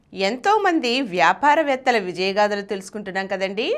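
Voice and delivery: usual speaking voice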